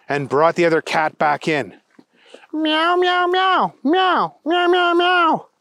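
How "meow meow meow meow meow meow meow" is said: very high, piercing cat meows; shouted meows. A narrator's reading. Thunder Kitty showing everyone the other cat, shouted meows. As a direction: yowl it out as an excited kitty. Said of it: "shouted Thunder Kitty".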